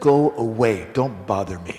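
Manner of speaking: lightly acted annoyance; a little annoyed. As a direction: wave them away with a touch of annoyance. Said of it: "got a little annoyed".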